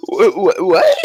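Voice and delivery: gasping stammered disbelief; shocked gasp. Retold or imitated. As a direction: stutter out the shock after a gasp. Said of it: "So he was like".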